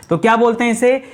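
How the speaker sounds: usual speaking voice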